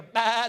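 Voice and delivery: a mock goat cry; imitated goat bleat.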